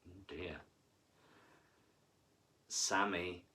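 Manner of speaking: plain reading voice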